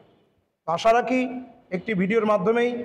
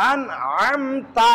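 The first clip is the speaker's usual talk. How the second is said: usual speaking voice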